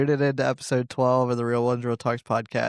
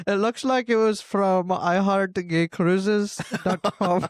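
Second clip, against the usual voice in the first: put-on higher Indian-accented call center voice; Indian telemarketer accent; 5 semitones higher, slower than usual. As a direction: play an Indian phone scammer. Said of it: "Indian telemarketer".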